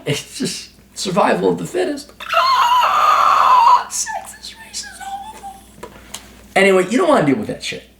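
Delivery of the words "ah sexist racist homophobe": high, shrieking parody of outraged critics; mock shrieking accuser. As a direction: screech the insults like a hysterical accuser. Mocking accusers shrieking insults.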